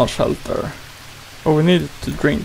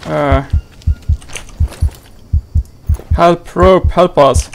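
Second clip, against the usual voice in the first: panicked call; 6 dB louder, slower than usual. Real, not acted. Panicking in danger.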